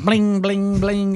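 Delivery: high sung bling bling; phone chime imitation. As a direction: imitate a ringing phone alert. Imitating phone text alerts.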